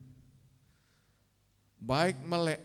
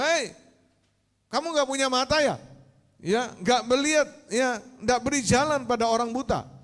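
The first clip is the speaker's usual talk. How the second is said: high-pitched indignant telling-off; angry scolding; 8 semitones higher, 5 dB louder than usual. Acted. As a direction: scold the careless passer-by, voice jumping up. (memarahi dengan nada tinggi)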